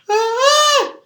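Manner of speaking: sudden high-pitched shriek, loud; short loud squeal. Acted. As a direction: let out a piercing squeal.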